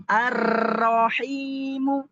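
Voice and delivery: ar-rahimu high and slow with long r; drawn-out ar-rahimu with tashdid; pressed, doubled r in ar-rahimu. Shown as a demonstration. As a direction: lean hard into the r of ar-rahimu. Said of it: "seperti ditekan karena ada ditasydidkan".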